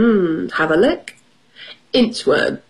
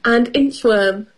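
ordinary delivery, nothing marked

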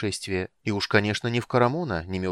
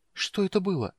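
faltering frightened voice; 10 semitones higher than usual. A narrator's reading. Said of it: "спросила она замирающим голосом".

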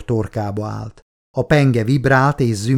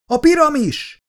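high alarmed shout; startled high cry. Acted. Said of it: "kiáltott fel Wieland".

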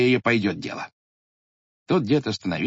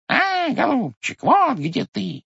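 much higher, slow squeaky beak voice; squeaky bird beak; 12 semitones higher, slower than usual. Acted. Bird's beak pecking the pot, high squeak. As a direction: squeak it like a tiny bird's nose. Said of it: "запищал птичий нос".

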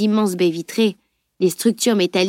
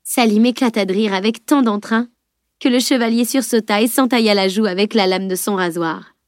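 ordinary delivery

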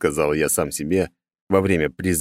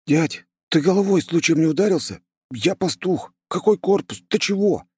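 put-on high, frightened young voice; a scared shepherd boy, bewildered; scared and confused; 11 semitones higher than usual. A narrator's reading. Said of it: "Голос его звучал испуганно и даже растерянно".

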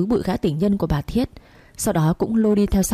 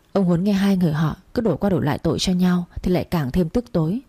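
plain reading voice

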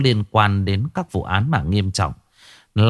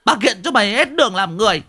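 high, forceful ultimatum; scolding woman turned hard and commanding; steely, hard voice; 13 semitones higher than usual. A narrator's reading. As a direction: lay down threats relentlessly in a high voice. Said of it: "giọng của mụ lại đanh thép".

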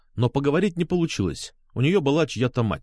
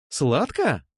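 gleeful little question; joyful exclamation; 3 semitones higher than usual. A narrator's reading. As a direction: ask it with a touch of joy. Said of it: "радостно воскликнула она". (радостный возглас-вопрос)

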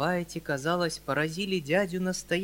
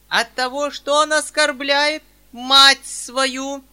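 much higher, very loud, slow threat; loud slow menacing accusation, much higher; 11 semitones higher, 15 dB louder, much slower than usual. Acted. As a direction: thunder it at your son. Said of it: "грозно проговорила".

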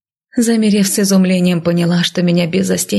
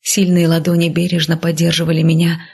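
plain reading voice

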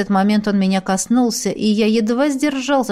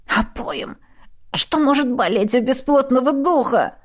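wheezing, hoarse rasp; 5 semitones higher than usual. A narrator's reading. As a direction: rasp it out breathless. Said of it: "прохрипел я".

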